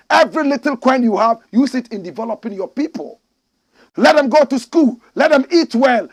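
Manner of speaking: shouted high and loud; preacher commanding leaders to stop corruption; fiery exhortation. Acted. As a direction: drive the message at the leaders.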